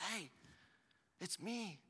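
put-on calm him like a frightened child; Jesus soothing sinking Peter, softly; tender reassurance.

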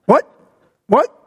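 repeated short "what?", rising sharply; hard-of-hearing mom. Retold or imitated. Mom baffled by granddaughters' conversation, not hearing. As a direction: keep asking as if you can't hear. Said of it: "my mother would be like".

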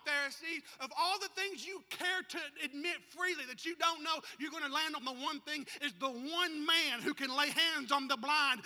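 loud, high fervent preaching; preaching at full force. Acted. Preacher rousing the church at full force. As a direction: drive it home like a revival preacher.

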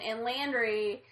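usual speaking voice

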